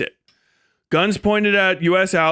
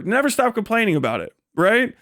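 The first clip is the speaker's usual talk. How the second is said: normal talking voice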